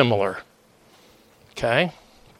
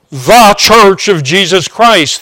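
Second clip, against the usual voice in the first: "the" hit loud and high as THEE before church; emphatic THE, loud and raised; stress on THE; 6 semitones higher, 8 dB louder than usual. Real, not acted. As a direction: proclaim THE church, leaning hard on "the". Capital-letter Church against local church.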